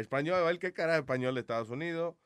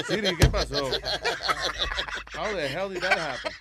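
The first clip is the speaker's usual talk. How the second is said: laughing; 14 semitones higher, 5 dB louder than usual. Real, not acted. Laughing at Siri's insult.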